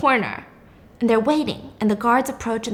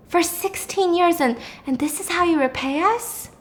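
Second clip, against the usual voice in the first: higher, wounded reproach; guilt-trip her; aggrieved doctor; 5 semitones higher than usual. Retold or imitated. Doctor's aggrieved speech, retold.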